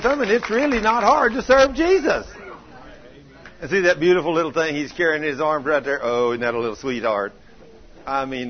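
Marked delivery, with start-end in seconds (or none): 0.0-2.3 high, loud, emphatic quote; exuberant testimony. Retold or imitated. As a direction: proclaim it high and loud like a glad discovery. Retells Philip's glad testimony.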